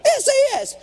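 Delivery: exasperated shout. Real, not acted. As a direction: shout the initials in frustration. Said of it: "How hard are three initials?".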